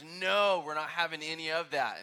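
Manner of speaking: loud, emphatic no; a forceful refusal on Paul's behalf; firm loud refusal. Retold or imitated. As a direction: shut it down hard. Paul flatly rejecting false teaching.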